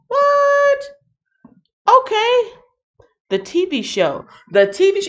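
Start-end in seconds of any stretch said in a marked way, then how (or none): 0.1-2.5 high, sudden surprised what. Real, not acted. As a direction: react with genuine disbelief. Surprised by chat comment.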